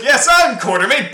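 high, loud mock declaration; hammed-up eager announcement. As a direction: blurt it out proudly as a cartoonish impostor. Impostor eagerly answers in retold scene.

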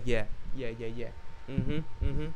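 low, subdued nodding-along murmur; passive agreeing. Retold or imitated. Imitating himself as the agreeable sidekick, low passive agreement. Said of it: "I'm the guy that's just like".

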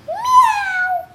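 squeaky high meow; high kitten meow. Pretend. Child voicing the book's cat, squeaky meow. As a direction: mew like a tiny kitten. Cat ending the dog book.

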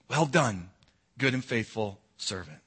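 very soft, low, tender words of praise; tender blessing. Acted. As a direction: bless them gently, like a proud father. Christ speaks to faithful believer.

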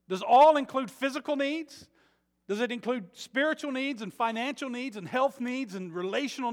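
loud, high preaching at full force; fiery preaching. Acted. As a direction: drive it home to the congregation.

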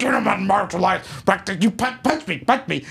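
mimicked garbled dare, tripping over words; mock speech impediment. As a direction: sputter a challenge as a mocking impression. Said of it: "he had a speech impediment".

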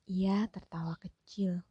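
usual speaking voice

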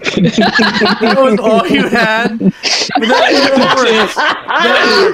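talking through laughter; laughing. Real, not acted. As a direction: laugh while teasing. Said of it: "laughing at the intro".

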